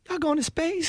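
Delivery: high, playful imitation of a woman; imitating the janitor. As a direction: raise the voice high to mimic her. Imitating lobby janitor's question.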